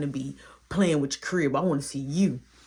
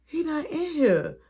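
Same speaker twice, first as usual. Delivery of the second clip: much higher, slow anxious; worried despair; 11 semitones higher than usual. Retold or imitated. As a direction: dread sinking in. Despair searching.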